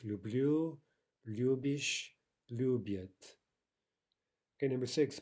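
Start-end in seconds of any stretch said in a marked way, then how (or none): 0.0-3.4 люблю stressed on the end, любишь and любит on the start; shifting stress. Shown as a demonstration. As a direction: show the stress jumping back from -блю to лю-. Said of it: "Note the shifting stress".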